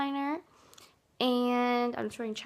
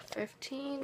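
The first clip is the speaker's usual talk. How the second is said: usual speaking voice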